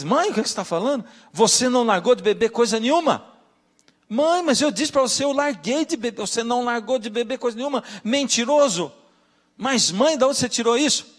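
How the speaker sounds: mother and son shouting over his drinking; heated family quarrel. Retold or imitated. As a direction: argue back and forth like mother and son.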